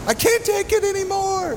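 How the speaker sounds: very high-pitched, hammed-up breaking-point cry; overwhelmed yell. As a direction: yell it as if drowned out by chaos. Acts out being overwhelmed.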